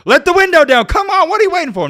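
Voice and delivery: high, loud mocking demand at the car window; hammed-up impression of a boyfriend yelling; mock angry boyfriend. Mocking the boyfriend banging on her car window, loud and high. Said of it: "No, I'm just playing".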